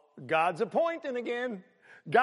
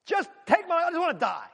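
anguished plea; 10 semitones higher, 6 dB louder than usual. Acted. Jonah's despairing plea voiced.

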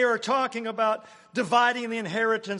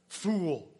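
FOOL said with weight, falling; emphatic; 3 semitones lower than usual. Shown as a demonstration. Preacher showing God's emphatic word Fool, with a heavy fall. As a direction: land the word Fool hard. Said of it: "It's emphatic".

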